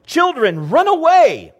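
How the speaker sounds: put-on a mother screaming at her kids in panic; panicked scream. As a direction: yell it high and loud, horrified. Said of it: "she screamed".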